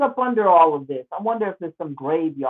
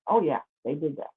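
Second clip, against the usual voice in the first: ordinary talk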